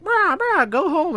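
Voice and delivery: very high, loud crow squawk; mock crow voice. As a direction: caw the words like heckling crows. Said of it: "There's a bunch of crows".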